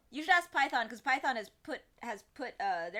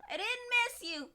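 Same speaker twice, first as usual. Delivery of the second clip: a sulky pet pretending indifference; high mock-offended cat voice; huffy cat, much higher; 13 semitones higher, 6 dB louder than usual. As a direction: squeak it high and petulant. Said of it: "and then he's like".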